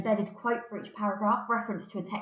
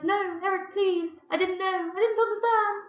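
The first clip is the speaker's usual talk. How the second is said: high, desperate pleading; pleading mother; 12 semitones higher, 6 dB louder than usual. Acted. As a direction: beg him to stop, frightened. Mother pleading with furious son.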